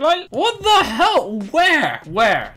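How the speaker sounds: astonished. Real, not acted. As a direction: gasp out your disbelief at the vanished cat. Cat vanishes into tiny space.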